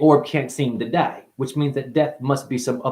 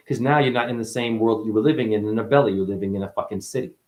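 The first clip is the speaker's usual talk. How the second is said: normal talking voice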